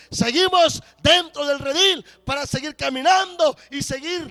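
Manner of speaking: high, loud, building blessing cry; fervent preaching shout. Acted. Pastor blessing Primera Iglesia de Guerrero Negro, fervent loud preaching. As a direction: proclaim it at full force over the church. Prayer rises to full preaching force.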